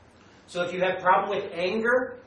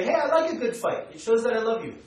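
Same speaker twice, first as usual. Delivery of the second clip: higher, lightly playing a fiery lover who enjoys a fight; passionate French lover; 5 semitones higher, 3 dB louder than usual. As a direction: say it like someone who loves a good fight. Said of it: "they say".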